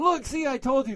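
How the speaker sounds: high, loud told-you-so; retold vindication. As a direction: imitate excitedly nudging a wife to prove a point. Acts out telling wife excitedly.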